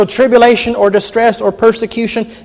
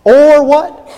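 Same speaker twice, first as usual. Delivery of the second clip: higher, coaxing the answer out; prompting question; 7 semitones higher, 5 dB louder than usual. Shown as a demonstration. Preacher quizzing congregation, playful prompt. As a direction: lift it to draw the congregation in. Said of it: "look at that last word there".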